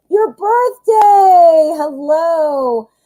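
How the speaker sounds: loud, high, long-drawn birthday cheer; excited birthday greeting. Real, not acted. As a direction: call it out high and stretch every word. Greeting viewer on their birthday.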